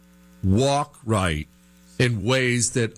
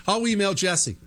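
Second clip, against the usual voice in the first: normal talking voice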